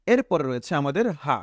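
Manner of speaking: usual speaking voice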